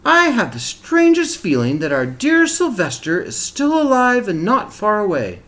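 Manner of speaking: mother sensing her lost son nearby; lightly voiced mother. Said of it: "she said suddenly".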